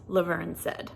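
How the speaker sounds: usual speaking voice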